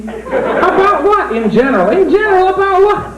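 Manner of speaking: high, slow, dazed puppet voice; stoned puppet. Acted. Rabbit puppet stoned and confused, high dazed voice. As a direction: drift it out dopey and high.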